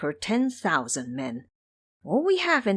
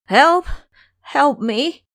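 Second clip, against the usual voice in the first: loud, high cry for help; shouting for help; 8 semitones higher, 10 dB louder, much slower than usual. Acted. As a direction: yell it out like a man lying hurt. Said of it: "he shouted".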